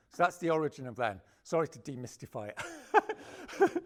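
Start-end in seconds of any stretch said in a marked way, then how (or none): 1.4-3.8 slow, amused apology with a laugh. Real, not acted. To the audience, apologizing through a laugh. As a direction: laugh it off while apologizing to the crowd. Laughing self-deprecating aside.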